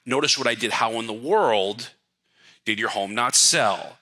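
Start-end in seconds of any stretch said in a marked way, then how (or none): 0.7-1.9 slow, rising on world; upswing. Shown as a demonstration. 2.7-4.0 slow, falling onto sell; downswing. Shown as a demonstration. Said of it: "the downswing on the end".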